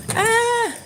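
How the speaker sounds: sudden high yelp; startled yelp. Real, not acted. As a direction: jump at a sudden noise. Bike falling over.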